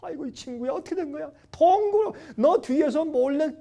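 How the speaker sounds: high-pitched dismayed exclaiming; dumbfounded friend. Retold or imitated. Friends visit ruined Job, high dismayed questioning. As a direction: imitate a shocked friend, pitch climbing. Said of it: "새 친구가 와서 보니까 기가 막혀".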